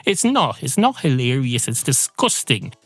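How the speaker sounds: ordinary talk, nothing marked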